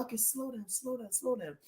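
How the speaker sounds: hushed plea. Retold or imitated. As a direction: plead softly under the breath. Retelling asking nephews to slow.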